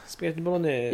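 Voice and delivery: Spaghetti bolognese said with Italian vowels, softly; playful put-on Italian; Italian-style bolognese. Shown as a demonstration. Friend shows how he says it, mock Italian. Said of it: "I always say spaghetti bolognese like that".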